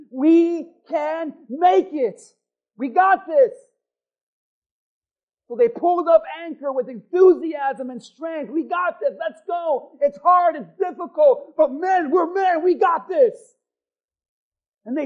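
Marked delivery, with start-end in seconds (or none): 0.1-3.5 loud and slow, leading the crowd; call to the congregation. Shown as a demonstration. Preacher leads the church in a loud, slow chant. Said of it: "Everyone say that with me". 8.5-13.6 rallying sailors. Retold or imitated. Voicing sailors rallying each other.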